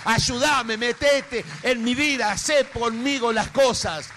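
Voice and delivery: loud, high, desperate cry to God; pleading prayer. Acted. Preacher voicing a believer crying out to God, loud pleading. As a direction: beg the Lord for help at full voice. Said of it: "Empieza a decir".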